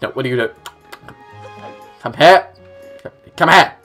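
ordinary talk, nothing marked

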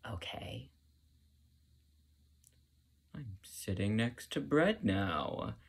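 soft, slow start to a resigned aside; mock resigned. Student sitting back beside unfinished toast, resigned. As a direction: settle back into slow, forced acceptance. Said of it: "So you sit back like".

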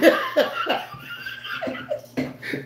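shrill high-pitched laughing burst; high cackle. Real, not acted. Streamer cackling at rival after sign-off, high laugh.

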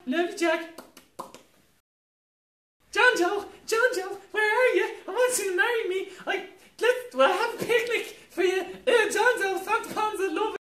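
put-on very high, loud giggly girl; swooning falsetto maiden. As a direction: squeal it lovestruck, laughing. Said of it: "I'm gonna do all the roles".

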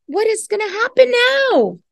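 ordinary delivery, nothing marked

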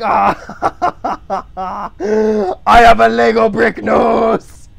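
high laugh into a loud slow shout; hammed-up high, loud boast; caricature of the drawing. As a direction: bellow it as a silly cartoon face. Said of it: "it's like".

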